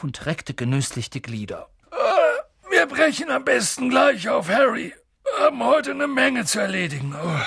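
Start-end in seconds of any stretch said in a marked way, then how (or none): 1.9-7.4 high, loud yawn through the words; Hagrid, yawning. Acted. Waking giant planning the day's errands, yawning. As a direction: stretch and talk mid-yawn. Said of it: "Hagrid gähnte laut, richtete sich auf".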